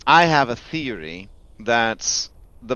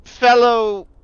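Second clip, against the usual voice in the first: insect voice; 6 semitones higher, slower than usual. Acted. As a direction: call out like an eager insect. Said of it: "you say that in, in insect".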